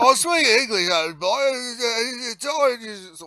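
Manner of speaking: high, loud garbled Cockney parody; hammed-up Cockney British accent; mock Cockney. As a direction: exaggerate an unintelligible Cockney speaker. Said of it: "that Cockney British accent".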